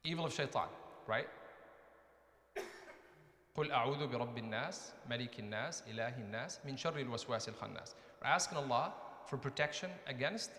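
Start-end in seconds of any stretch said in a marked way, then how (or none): 3.6-7.9 rapid, lower recited Arabic; Quran recitation. Shown as a demonstration. Teacher reciting Surah An-Nas for students, quick recitation. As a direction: rattle off the verses like a memorized recitation.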